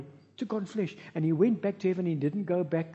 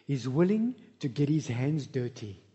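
usual speaking voice

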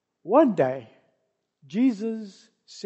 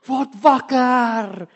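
slow, drawn-out louder shout; mock shout; 8 dB louder, much slower than usual. Disciples shouting to wake Jesus, slow loud shout. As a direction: call out to wake the sleeper.

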